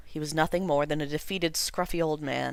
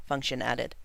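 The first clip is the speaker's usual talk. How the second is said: ordinary delivery, nothing marked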